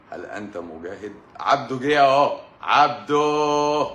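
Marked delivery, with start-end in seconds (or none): 1.4-3.9 عبده called out loudly, higher and slow; excited call. Real, not acted. Host calling out excitedly as Abdo joins the live. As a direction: shout a happy welcome to Abdo as he joins. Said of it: "calling out to Abdo on the live".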